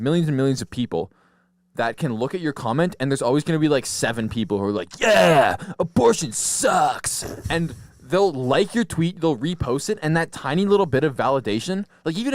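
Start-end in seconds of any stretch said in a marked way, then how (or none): 5.0-7.2 loud, drawn-out mock endorsement; hammed-up cheer, stretching the last word; mock online cheering. Imagined Twitter follower backing a hot take, mock loud cheering. Acting out approving Twitter users.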